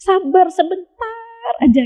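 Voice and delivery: calm them down like a patient parent; reassuring God. Retold or imitated. Voicing God reassuring tested believers, high and soothing. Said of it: "Kata Allah".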